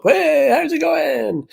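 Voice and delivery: very high, long drawn-out hello; mock cheery greeting. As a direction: sing out a carefree greeting, imitating the returning friend. Imitating friend popping back up.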